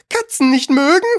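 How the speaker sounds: high indignant squeal of outrage; screeching, furious. A narrator's reading. Furious mouse answers Alice about cats, screeching. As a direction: shriek it as an offended mouse. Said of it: "schrie die Maus mit kreischender, wütender Stimme".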